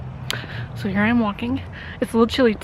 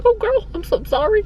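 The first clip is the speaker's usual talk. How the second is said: sympathetic; 9 semitones higher than usual. Retold or imitated. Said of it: "And I was like".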